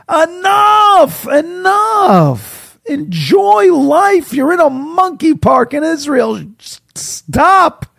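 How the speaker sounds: a high, desperate plea to stop talking; exasperated shout. Acted. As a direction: yell at him to finally shut up. Exasperated outburst at the talker.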